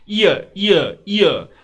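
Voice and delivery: "ईयर" high and falling, each on its own; pronunciation demo. Shown as a demonstration. Teacher drawing attention to the word, high emphatic "ईयर". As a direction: sound out "ईयर" emphatically, dropping at the end. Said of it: "अब ध्यान दीजिए".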